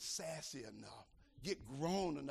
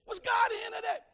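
high, loud shouted question; preaching shout; 15 dB louder, faster than usual. Acted. Preacher shouting at the congregation, full force. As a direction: hurl the question at the church.

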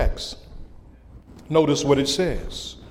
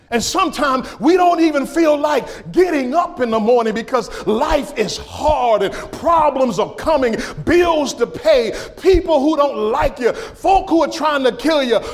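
higher, driving list that piles up; impassioned preaching; 9 semitones higher than usual. Real, not acted. Preacher listing life's hardships to the church, impassioned preaching. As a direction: pile up each trouble in a preacher's rhythm.

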